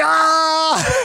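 high, loud mock cry of agony; imitating a cry of pain. Friend in agony on kitchen floor, hammed-up pained cry. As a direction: wail it like a pulled back. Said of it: "He's screaming".

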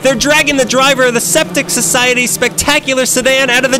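frantic, high, loud commentary; shrieking as the parade falls apart; panicked commentators. Acted. Parade float chaos.

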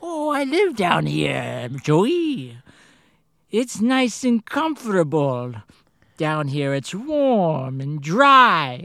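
sing-song sinister clown greeting; creepy clown voice. Retold or imitated. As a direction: lure him in like a creepy clown. Voicing the sewer clown.

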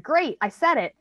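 imitated child's triumph. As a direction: voice a kid pleased at getting it out. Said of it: "we're all cheerful".

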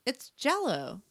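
acted answer. A narrator's reading. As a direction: lift the pitch as if stating the obvious. Narrator answers in the story.

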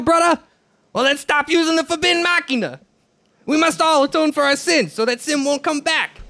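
a well-meaning zealot preacher in a mock Jamaican lilt; bad Jamaican accent. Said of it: "really shitty Jamaican accent".

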